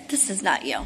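blunt Israeli border officer, low and hushed; flat low verdict. Retold or imitated. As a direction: drop it low and final. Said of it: "the lady's looking at this picture".

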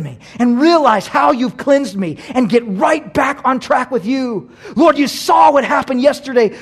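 loud, high-pitched pleading to God; fervent confession prayer. Acted. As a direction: cry out to God at preaching pitch.

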